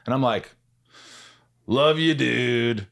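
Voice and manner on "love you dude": slow, awkward mock-casual love you; awkward forced-casual affirmation; forced cool. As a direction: fake being cool to the mirror. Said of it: "trying to be cool about it".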